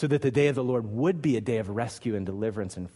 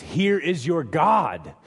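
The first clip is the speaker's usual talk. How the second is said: slow, raised, ringing announcement; loud proclamation; 5 semitones higher, 6 dB louder, much slower than usual. Acted. Herald announcing the good news, slow loud proclamation. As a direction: proclaim it loudly, word by word.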